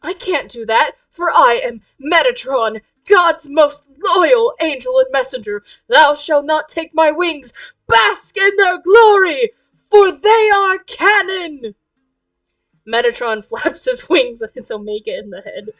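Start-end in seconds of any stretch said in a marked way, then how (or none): 0.0-11.7 theatrical proclamation rising to a loud shout; grandiose angel cosplayer. Acted. Metatron refuses Omen's demand, grandiose angel cosplayer.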